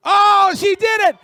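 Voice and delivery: high, loud thrilled shout; excited shout. Real, not acted. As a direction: shout it out in disbelief. Bidder jumps in, crowd excited.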